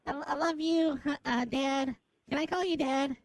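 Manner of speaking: ordinary delivery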